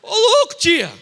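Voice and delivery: grito agudo e alto de choque; espanto gritado. Retold or imitated. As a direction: exclama chocado, bem mais agudo e alto. Reação chocada à pergunta. (sobrinho reage chocado à tia, grito agudo de espanto)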